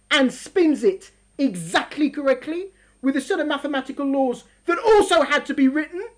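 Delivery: ordinary talk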